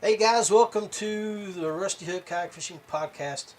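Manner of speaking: normal talking voice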